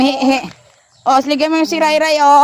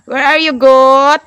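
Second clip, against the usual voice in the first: loud, drawn-out singsong call; playful calling out; 5 dB louder, slower than usual. Pretend. Looking for the goat with little Ray, playful calling out. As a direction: call out slowly for a hiding animal.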